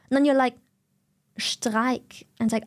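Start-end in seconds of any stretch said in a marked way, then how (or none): 1.4-2.2 Streik with a sh at the start, lower; German pronunciation. Acted. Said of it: "pronounce it German".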